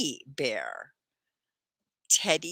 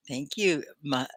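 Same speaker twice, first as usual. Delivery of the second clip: ordinary talk, nothing marked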